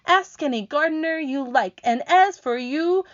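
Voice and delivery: high, loud, indignant retort; offended earthworm. Acted. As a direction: defend yourself huffily.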